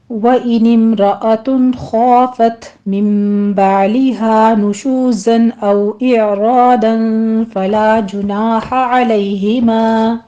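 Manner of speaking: slow, chanted tajweed reading; measured Quranic chant, long vowels held; Quran recitation. Shown as a demonstration. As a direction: recite the verse slowly as a model. Teacher recites the verse.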